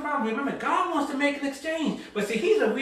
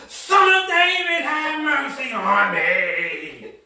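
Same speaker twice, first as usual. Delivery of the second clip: even bigger cry, the end stretched out long; long, defiant re-enacted wail for mercy; blind Bartimaeus; 5 dB louder, slower than usual. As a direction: holler it, then drag out the last word. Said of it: "people telling you to keep it down".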